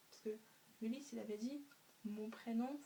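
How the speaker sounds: usual speaking voice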